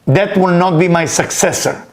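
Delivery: ordinary talk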